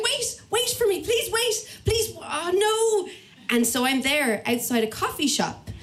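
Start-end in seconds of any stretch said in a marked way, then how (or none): 0.0-3.1 high, slowing, desperate calling after them; comic high-pitched wail of panic; panicked pleading. Retold or imitated. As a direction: beg them to wait as they ride off. Left behind, calling after cyclists.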